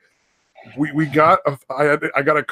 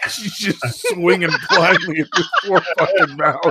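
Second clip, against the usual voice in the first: higher voice, breaking up with laughter; laughing while telling; 4 semitones higher than usual. Real, not acted. Son describing the hammer scene to friends, laughing. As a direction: struggle to get it out through laughs. Storyteller cracking up at grim memory.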